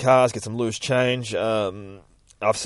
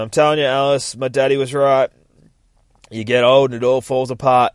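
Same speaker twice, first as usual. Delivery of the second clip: louder, higher complaining voice; ranting old man; 5 semitones higher, 9 dB louder than usual. Acted. Old man grumbling to Alice about aging, loudly ranting. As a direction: bang on about getting old.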